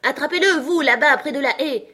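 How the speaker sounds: high, loud urgent command; rabbit's shouted order. A narrator's reading. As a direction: call out orders across a garden. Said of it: "Puis la voix du lapin seul". (ordre crié, voix aiguë et forte)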